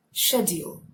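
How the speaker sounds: British SCHEDULE, starting with 'sh'; British accent. Shown as a demonstration. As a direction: say SCHEDULE the British way, SHED-yool. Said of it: "when you use the British accent".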